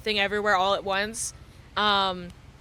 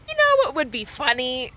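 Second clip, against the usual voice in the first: sly little goblin voice, lightly voiced; mischievous brain goblin; 2 semitones higher than usual. Inner goblin tempting her mid-cry, lightly sly. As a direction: tempt yourself like an inner imp. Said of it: "little goblin in my brain that went".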